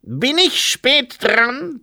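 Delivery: high, loud, drawn-out call; far-carrying, high-pitched voice; ringing call. A narrator's reading. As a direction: call it slowly through the night. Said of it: "tönte eine Stimme durch die Nacht".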